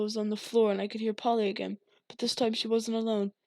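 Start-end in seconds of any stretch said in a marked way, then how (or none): none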